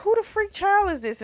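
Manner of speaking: high-pitched retold bafflement; startled disbelief. Narrator voices his thought on finding a child, baffled. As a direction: voice his puzzled double take.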